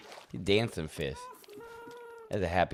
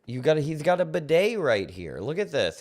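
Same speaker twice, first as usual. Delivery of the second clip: excited discovery; 8 semitones higher, 5 dB louder than usual. Real, not acted. Discovering a bidet in the house.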